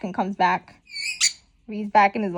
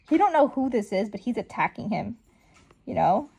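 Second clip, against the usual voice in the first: ordinary talk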